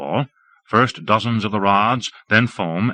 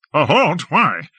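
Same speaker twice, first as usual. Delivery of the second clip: higher, louder eager retort; battle-fevered lord; 8 semitones higher, 3 dB louder than usual. A narrator's reading. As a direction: scoff at stopping. Lord scoffs mid-battle.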